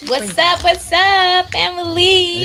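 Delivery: loud, high show opener; hype greeting. Acted. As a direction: call out to the audience. Podcast opener to audience.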